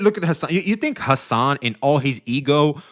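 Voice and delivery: normal talking voice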